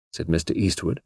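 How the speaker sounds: plain reading voice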